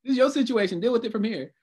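high-pitched exasperated brush-off; retold indignant pushback. Police and housing staff arguing over marijuana, indignant pushback. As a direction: hand the problem back in mock irritation. Imitates police passing problem back.

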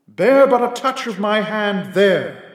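ghostly spirit voice. A narrator's reading. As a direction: speak as a calm, grave ghost. Ghost's lines in the tale.

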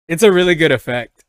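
normal talking voice

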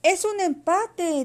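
high, loud, slow proclamation; announcing call. A narrator's reading. Carlos announces the tie to both rivals, calling out. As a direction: call out the result, drawn out.

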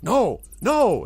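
high, loud, swooping cries; excited. Acted. Goblin turns to his new friends, crying out excitedly. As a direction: cry out like an overexcited little goblin. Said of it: "jumps up and down excitedly".

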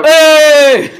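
high, very loud drawn-out yell; screamed greeting. Pretend. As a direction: shriek a long hello.

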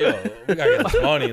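high, loud laughing plea; retold urgent insistence. Storyteller was broke and urging her to stay, insistent. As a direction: insist loudly, half laughing.

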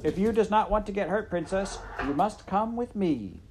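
high, loud shouted speech; shouted recitation. A narrator's reading. Midge ordering the princess to come, shouting. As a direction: shout it out like a memorised piece. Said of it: "shouted her piece".

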